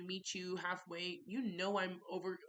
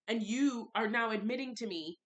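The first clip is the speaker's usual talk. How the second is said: ordinary talk, nothing marked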